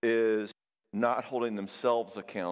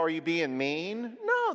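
ordinary talk

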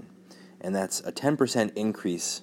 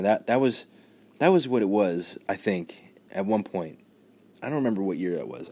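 ordinary talk

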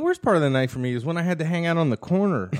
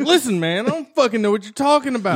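high-pitched defensive protest; mock yelling; 8 semitones higher than usual. Playing Daly who can't take criticism, mock yelling. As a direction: protest in a much higher voice. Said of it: "He starts yelling at me".

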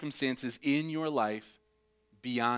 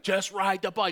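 exasperated dad; 7 semitones higher, 5 dB louder than usual. Retold or imitated. Dad calling to wobbling kid.

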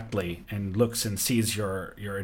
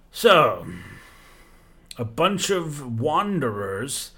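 a grand, amused giant king, pitched higher and unhurried; regal desert giant; 3 semitones higher, 4 dB louder than usual. Acted. The giant king greeting wanderers on his dunes, grand and amused. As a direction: savour every word like a king granting an audience.

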